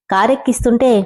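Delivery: plain reading voice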